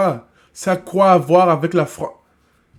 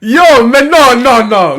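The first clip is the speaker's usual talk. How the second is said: loud, drawn-out protest; a loud, slow outburst of shocked denial; shocked outcry; 6 semitones higher, 10 dB louder, much slower than usual. Real, not acted. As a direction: cry out in disbelief. Shocked outcry at friend's story.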